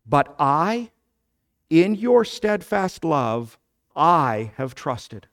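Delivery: "I" set apart by pauses and leaned on both times; slow, deliberate reading that weights each "I"; stress on I. Shown as a demonstration. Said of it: "what is to be emphasized is the I".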